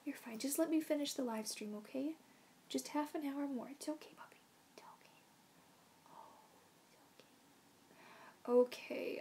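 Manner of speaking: calm the puppy down; tender coaxing; gentle reassurance. Real, not acted. Calming dog softly.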